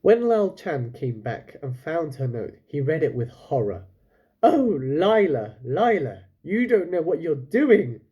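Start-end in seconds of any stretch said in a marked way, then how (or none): 4.4-8.0 loud, slow, despairing call of a father; anguished cry. A narrator's reading. Father finding his daughter's note, crying out in horror. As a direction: cry out her name in horror. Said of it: "he cried".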